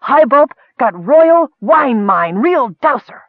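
high, loud, slow boast; proud proclamation. A narrator's reading. Miner announcing the wine mine to his leader, proud. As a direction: trumpet the great find. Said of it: "Skit repeated proudly".

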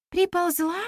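high questioning ПРИПОЛЗЛА, rising at the end; surprised echo. A narrator's reading. Girl questioning the storyteller about the goddess, surprised. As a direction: echo it back as a puzzled question. Listener echoes the word in surprise.

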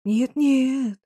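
put-on flustered old woman's protest; frightened mumble. A narrator's reading. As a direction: deny it fearfully. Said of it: "испуганно забормотала Капитолина".

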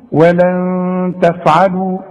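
very slow ولن تفعلوا, the nun held as a nasal hum; held ghunna. Shown as a demonstration. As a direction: stretch the nun in ولن for two beats. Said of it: "والغنة بمقدار حركتين".

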